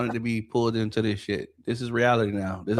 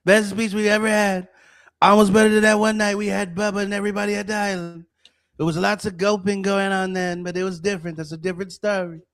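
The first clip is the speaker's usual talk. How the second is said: high-pitched, boastful politician impression; Trump; 8 semitones higher, 3 dB louder than usual. Boasting about a speech to the show, Trump impression. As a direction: brag in a put-on presidential voice, well above your own. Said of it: "Says the Trump pussy man".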